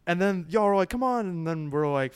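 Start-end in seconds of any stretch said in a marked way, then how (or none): 0.9-1.3 urging. Retold or imitated. Friends above urging them to climb.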